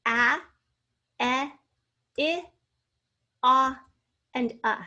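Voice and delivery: short vowels "a, e, i, a, uh", each alone with a pause after; short vowel sounds. Shown as a demonstration. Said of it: "our short vowels".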